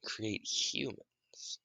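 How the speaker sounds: slow whisper. Real, not acted. Narrator musing, slow whisper. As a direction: breathe it out slowly.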